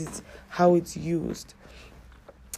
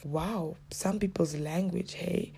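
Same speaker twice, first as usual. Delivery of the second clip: hushed, disapproving remark; quiet mock disapproval; snide aside; 10 dB quieter, slower than usual. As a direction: say it softly, meant to be overheard. Retells bystander's snide aside.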